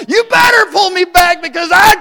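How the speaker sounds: high, loud acted-out threat yell. Enraged boy to the bus driver pulling him away, yelling. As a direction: scream it like a kid being held back. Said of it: "and I'm yelling".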